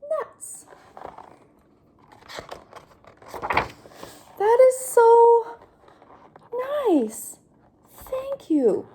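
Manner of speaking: high, slow, thrilled thanks; overjoyed pigeon. Acted. Pigeon thanks duckling for the nutty cookie, high. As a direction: gush with joy.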